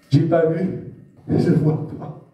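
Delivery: lightly voiced stubborn doubter, slow and half-whispered on "je vois pas"; mocking unbeliever. Preacher imitating unbelieving friends, mild mockery. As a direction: mimic a blind skeptic's denial.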